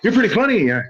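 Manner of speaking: high, loud imitation of the director; eager director. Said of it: "he comes up to me and said".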